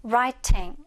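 "writing" with the t fully sounded; British "writing", t not softened; British pronunciation. Shown as a demonstration. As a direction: pronounce a true t in the middle of "writing". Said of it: "이렇게 발음해주면 됩니다".

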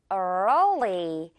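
early drawn out, R then L; slow early. Shown as a demonstration. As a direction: stretch out early. (early를 길게)

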